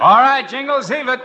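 high shout to the shore; calling out. Acted. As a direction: yell over the current. Calling from the water.

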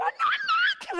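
squealed way up high in disbelief; starstruck squeal. Retold or imitated. Starstruck fan reacts to her crush's video, squealing. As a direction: shriek like a fan who can't believe it.

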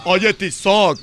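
drawn-out, heavy accusing call; slow soap opera drama. Acted. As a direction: drag out the name like a telenovela. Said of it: "imagínate que le dijera así".

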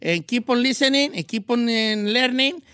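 Spanish-accented English, hammed up; joking English greeting with a Mexican accent; mock English with a Mexican accent. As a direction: show off his English with a thick Mexican accent. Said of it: "Very important to talk with no accent".

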